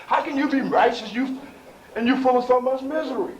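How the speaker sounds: high, loud sermon challenge, leaning hard on key words; impassioned preaching. Real, not acted. Preacher challenges the congregation on misery and righteousness, impassioned preaching. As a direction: preach it at full force, voice pitched high.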